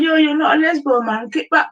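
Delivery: high-pitched mock scold; high mocking impression. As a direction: squeak it out like a mocking friend. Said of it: "he's gonna be like".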